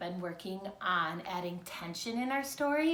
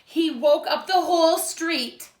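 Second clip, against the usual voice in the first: put-on lively read-aloud for young children; storybook reading; 7 semitones higher, 10 dB louder than usual. A narrator's reading. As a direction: read it like a picture book to second graders. Teacher reads picture book aloud.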